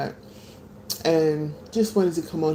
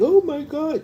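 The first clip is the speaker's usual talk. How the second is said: mock crying; 12 semitones higher than usual. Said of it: "crying and stuff".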